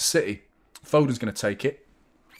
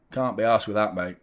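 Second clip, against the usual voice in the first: normal talking voice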